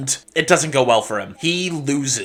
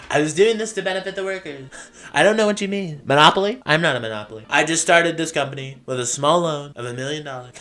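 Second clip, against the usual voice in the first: put-on Donald Trump accent; much faster than usual. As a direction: rattle it off fast and breezy in a brash Trump accent. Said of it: "trying to give him a Donald Trump accent every time".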